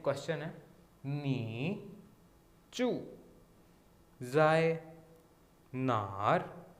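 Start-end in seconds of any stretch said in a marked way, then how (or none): 1.1-6.7 slow word by word, जाए louder; careful Chinese sentence. Shown as a demonstration. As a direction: read the sentence word by word for learners. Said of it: "sentence में पढ़ते हैं". (शब्द-शब्द अलग करके धीमे पढ़ना)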